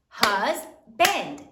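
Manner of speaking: husband broken into "huz" and "bend"; split into syllables. Shown as a demonstration. Teacher counting syllables for third grade, split into syllables. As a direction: punch each syllable of husband separately. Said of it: "How many syllables are there in husband?".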